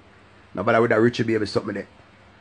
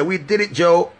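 higher catchphrase; 7 semitones higher, 4 dB louder, slower than usual. Shown as a demonstration. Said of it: "But you hear me say".

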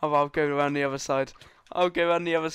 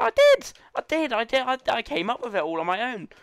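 indignant retold protest; 8 semitones higher than usual. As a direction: protest like a wronged kid, pitch shooting up. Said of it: "I was like".

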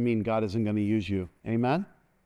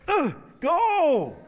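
sudden high shout of go; shouted command; 5 dB louder than usual. Real, not acted. Preacher shouting at a volunteer to go. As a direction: bark the word go at them.

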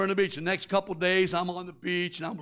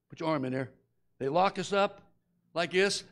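usual speaking voice

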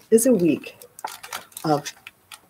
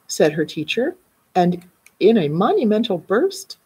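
ordinary delivery

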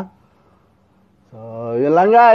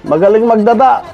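raised pitch, hyped fight reaction; excited commentary; 7 semitones higher than usual. Real, not acted. Fight watcher hyping up Russell, excited and high.

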